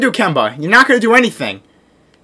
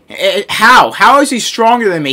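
normal talking voice

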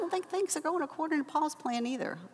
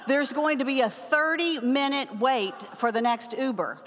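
ordinary talk

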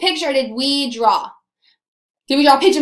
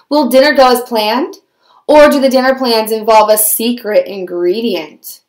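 loud, low storyteller voice; dramatic story narration; 3 semitones lower, 7 dB louder than usual. A narrator's reading. Narrator introduces hungry fox and plump goose, loud and dramatic. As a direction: narrate it like a trailer, punching key words.

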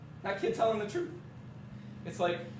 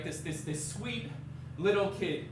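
usual speaking voice

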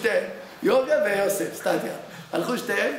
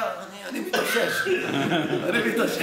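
shaken, laughing. Retold or imitated. The Yemeni yeshiva student after washing the dead, dazed and laughing. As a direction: play it stunned, half laughing. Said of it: "איך היה".

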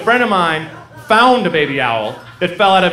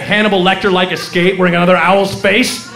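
ordinary delivery

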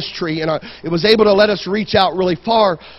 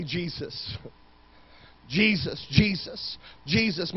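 hushed calling on Jesus; 9 dB quieter, slower than usual. Retold or imitated. Preacher showing how he stops and calls on Jesus, hushed. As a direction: say the name as a quiet prayer. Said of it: "had to stop and say".

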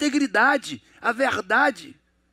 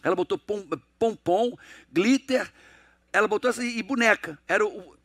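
normal talking voice

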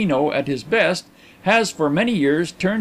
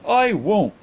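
higher-pitched, terse refusal; 5 semitones higher than usual. A narrator's reading. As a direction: refuse briefly and firmly. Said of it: "replied Carlyle tersely".

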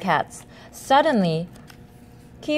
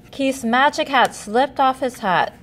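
plain reading voice

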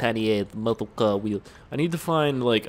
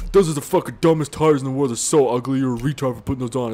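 a hint of a loud angry commenter; mock a loud hater reading his comment; 4 dB louder than usual. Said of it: "a comment that's like".

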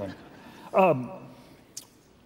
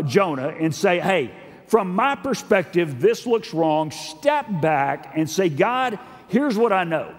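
raised, emphatic sermon delivery; forceful preaching; 6 semitones higher, 4 dB louder than usual. Real, not acted. Preacher urging congregation to step back, forceful. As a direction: preach it with urgent, punched key words.

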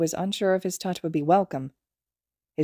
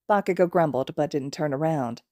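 plain reading voice

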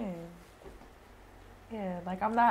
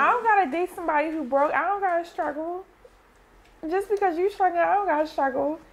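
emphatic; 8 semitones higher than usual. Real, not acted. Emphatic stance on broke men.

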